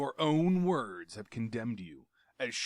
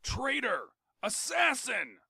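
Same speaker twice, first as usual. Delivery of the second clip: high, loud shriek of betrayal; screamed accusation; 9 dB louder than usual. A narrator's reading. Tario screaming at Jav, high and loud. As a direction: scream it at the traitor. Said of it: "he screamed".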